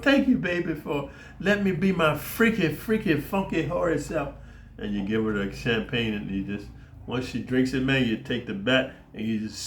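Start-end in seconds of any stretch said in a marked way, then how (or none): 0.0-4.3 mock sweet toast. Boyfriend mocking girlfriend.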